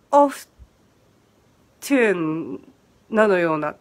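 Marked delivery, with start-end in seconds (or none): 0.1-2.7 オフトゥン with the T pronounced, British-style; British sounded T. Shown as a demonstration. Teacher trying out the British English form, オフトゥン with sounded T. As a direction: split オフトゥン before a drawn-out T syllable. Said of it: "イギリス英語が".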